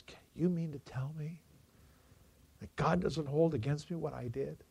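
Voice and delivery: breathless, awed whisper; hushed whisper. Retold or imitated. Old veteran whispers to the pastor, afraid to believe. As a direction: barely breathe the question in disbelief.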